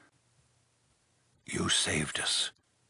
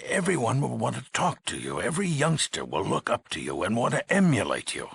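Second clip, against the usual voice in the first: higher, louder, with sweeping emphasis; agitated warning; 7 semitones higher, 5 dB louder, faster than usual. Acted. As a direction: build the worry with big pitch swings. Overseer foresees youngsters copying hero.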